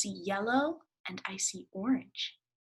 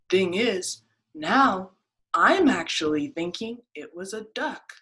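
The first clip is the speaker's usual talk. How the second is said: louder, sheepish rabbit; 9 dB louder than usual. Acted. Rabbit changing its mind to the duck, louder.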